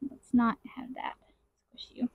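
hushed whisper to herself. Real, not acted. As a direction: drop to a breathy whisper. Whispered aside while handling frames.

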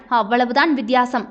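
ordinary delivery

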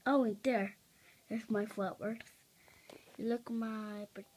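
ordinary talk, nothing marked

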